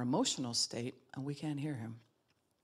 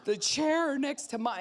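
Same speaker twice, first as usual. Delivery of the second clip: put-on higher, slow anxious child; anxious toddler; 10 semitones higher, 5 dB louder, slower than usual. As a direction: mime a toddler weighing a big choice.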